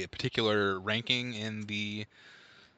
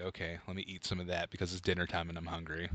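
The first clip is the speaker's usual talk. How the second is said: softer, fast reluctant mumble; begrudging; 5 semitones lower, 7 dB quieter, faster than usual. Retold or imitated. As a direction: grudgingly give in to dinner, quickly and softer. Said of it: "begrudgingly be like".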